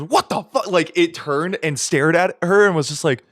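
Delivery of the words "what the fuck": startled deer. Retold or imitated. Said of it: "it fucking jumps and goes".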